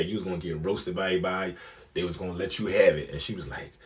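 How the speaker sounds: usual speaking voice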